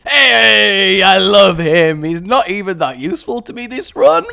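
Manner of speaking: loud delighted gush; excited. Real, not acted. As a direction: gush loudly over him. Delighted at a happy item.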